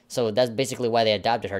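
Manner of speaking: ordinary delivery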